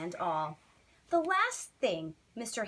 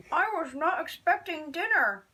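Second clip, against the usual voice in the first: startled neighbour mumbling mid-bite; mumbling with mouthful; 7 semitones higher, 5 dB louder than usual. Acted. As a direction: mumble through a mouthful of meatballs, higher and a touch louder. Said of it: "she mumbled with a mouthful of meatballs".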